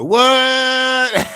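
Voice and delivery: high, loud surprised "What?"; genuinely taken aback. Real, not acted. Sees a show in his recommendations.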